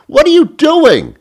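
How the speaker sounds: very high loud what are you doing; piercing exasperated yelp; shrieking disbelief. Retold or imitated. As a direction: screech it in outrage. Rant climax.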